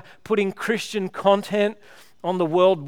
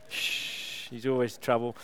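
hushed aside; 7 semitones lower, 10 dB quieter, slower than usual. Real, not acted. Joking aside about Jamie.

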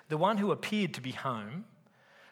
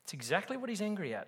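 ordinary delivery

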